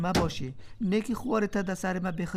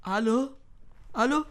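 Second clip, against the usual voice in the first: ordinary delivery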